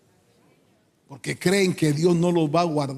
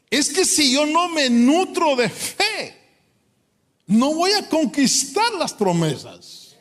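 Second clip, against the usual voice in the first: fervent preaching; 6 semitones higher than usual. Acted. Preacher pleading before the congregation, high and impassioned. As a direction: pour out the longing to see promises fulfilled.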